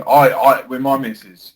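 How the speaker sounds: ordinary delivery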